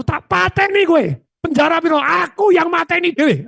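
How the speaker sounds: high, slow hammed threat; high mock threat from a feuding partner; retold threat. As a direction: growl a boastful death threat. Retold feud threat quoted.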